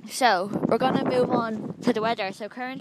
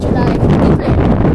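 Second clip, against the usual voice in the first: loud slow question; 11 dB louder, slower than usual. Acted.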